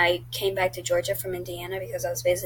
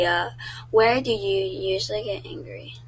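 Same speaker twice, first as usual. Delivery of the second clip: ordinary talk, nothing marked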